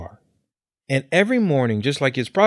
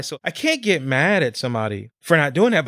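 ordinary talk, nothing marked

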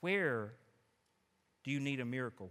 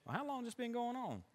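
casual; 8 semitones higher, 5 dB quieter, faster than usual. Shown as a demonstration. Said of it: "put his hand in his pocket and said".

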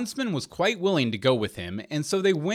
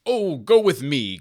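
slow, louder call to join; leader's drawn-out cry; 6 dB louder, slower than usual. A narrator's reading. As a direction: call it out with a long 'Oh'. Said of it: "cried the leader".

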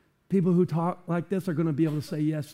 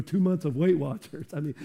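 ordinary talk, nothing marked

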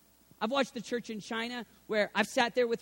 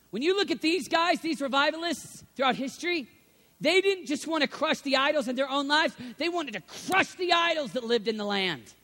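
louder, higher, full-force preaching; fiery preaching; 6 semitones higher, 8 dB louder than usual. Acted. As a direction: preach it to the back row.

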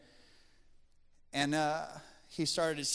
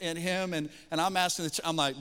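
ordinary talk, nothing marked

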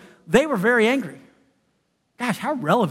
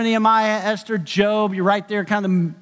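ordinary talk

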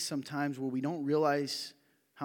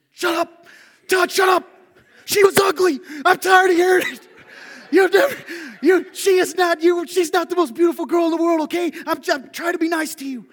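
high, loud mock shout; screeching, exasperated shout, breaking into laughter; friend yelling; 17 dB louder than usual. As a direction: yell it down at your moping friend. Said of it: "And he goes".

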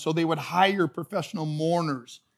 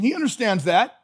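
ordinary talk, nothing marked

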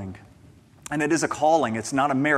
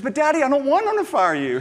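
put-on much higher, pleading child voice; worried little girl; 10 semitones higher, 3 dB louder, faster than usual. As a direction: play his nine-year-old begging him. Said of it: "She goes".

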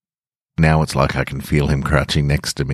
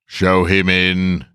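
slow, higher, louder royal announcement; grand wizard announcing; 4 semitones higher, 4 dB louder, slower than usual. A narrator's reading. Grand Wizard announcing to the courtiers, slow and grand. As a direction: proclaim it slowly like a grand wizard. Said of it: "the Grand Wizard announced".

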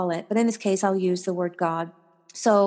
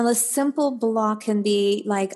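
ordinary delivery

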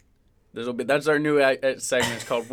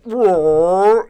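loud drawn-out stomach rumble; growling stomach imitation; 5 semitones higher, 11 dB louder than usual. Telling how his stomach went at council meeting, loud growl. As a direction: imitate a roaring, upset gut. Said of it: "my stomach's just like".

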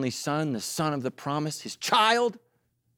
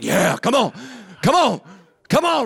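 a hype-up chant climbing higher and louder; building cheer; 7 semitones higher, 7 dB louder than usual. Acted. Preacher voicing the ovaries waking up, a building loud cheer. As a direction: cheer them on, building each time. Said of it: "The ovaries like".

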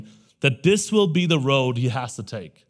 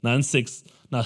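usual speaking voice

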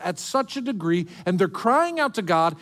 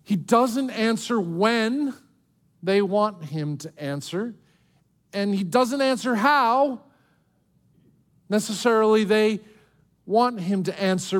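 ordinary talk

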